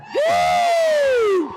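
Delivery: very high, ringing stage whoop; crowd whoop. Real, not acted. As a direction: whoop to get the crowd going. Comedian greets crowd on stage.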